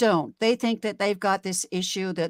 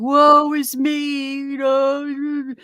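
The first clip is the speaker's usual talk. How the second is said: slow, high, drawn-out mock lament; woe-is-me whine; 5 semitones higher, 7 dB louder, much slower than usual. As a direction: ham up a self-pitying groan. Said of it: "This is the truckers going".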